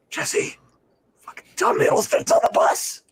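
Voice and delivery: high, urgent whispered squeal; whisper-scream it to your friend; excited stage whisper. Retold or imitated. Voicing excited friend on bus.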